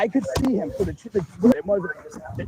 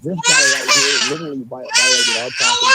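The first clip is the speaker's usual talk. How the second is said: upset crying; 5 dB louder than usual. Real, not acted. Upset owner reporting intruder.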